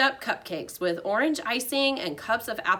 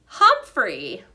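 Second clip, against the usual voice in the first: announcing winner; 9 dB louder than usual. Acted.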